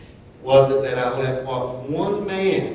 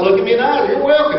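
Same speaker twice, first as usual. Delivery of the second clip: hearty welcome; 9 semitones higher, 5 dB louder, much faster than usual. Retold or imitated. Voicing handshaking congregant.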